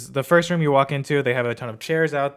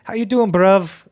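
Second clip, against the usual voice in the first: loud, high, slow mock British greeting; hammed-up British "bruv" voice; bad UK accent; 8 semitones higher, 10 dB louder, slower than usual. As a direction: belt out a badly faked British accent. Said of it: "I can't even do a freaking accent".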